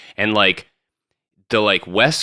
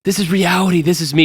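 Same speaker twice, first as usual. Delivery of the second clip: high-pitched exasperated reality check; mock pleading; 8 semitones higher than usual. Boyfriend talking sense into his mermaid-obsessed girlfriend, high mock plea.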